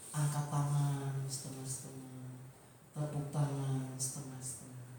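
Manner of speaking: low, soft, listless monotone; mock half-hearted. Preacher mimics worshippers lifting hands halfway, half-hearted. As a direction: act out worship with no energy.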